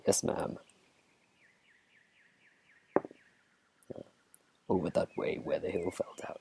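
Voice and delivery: hushed, husky crooner squirrel. Acted. Squirrel giving a player directions, mock crooner voice. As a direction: put on a smooth soul-singer squirrel.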